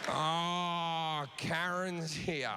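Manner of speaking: slow, sinking dread over Karen; a fan realising his night is ruined, slowly; dismayed fan. Retold or imitated. Fan sees Karen at the show, slow dismay.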